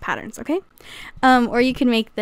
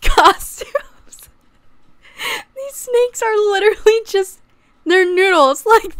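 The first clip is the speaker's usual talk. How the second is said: much higher, slowed, tearful laughter; laughing fit; 9 semitones higher, 4 dB louder than usual. Real, not acted. To viewers about snake costumes, laughing to tears. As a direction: cackle through the words, tears coming. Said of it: "I lost it".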